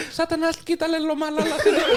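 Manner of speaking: mock exorcist. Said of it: "he'll go".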